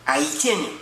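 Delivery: put-on lightly held Vincentian accent, chatty; a Caribbean cab driver holding court; Vincentian taxi driver. Taxi driver character skit.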